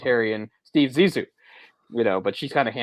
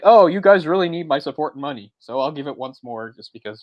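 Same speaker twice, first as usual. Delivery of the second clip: mock generous mother; 4 semitones higher, 7 dB louder than usual. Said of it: "it's like".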